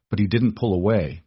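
ordinary delivery, nothing marked

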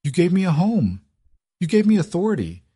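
high, trembling furious outburst; fierce anger. A narrator's reading. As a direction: let the anger pour out at him. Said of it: "her voice trembling with sudden, fierce anger".

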